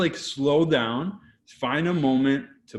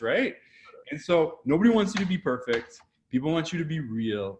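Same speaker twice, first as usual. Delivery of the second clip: ordinary talk, nothing marked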